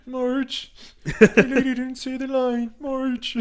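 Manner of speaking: very high loud cartoon whine; Homer Simpson voice. Friend doing Homer complaining to Marge, high cartoon whine.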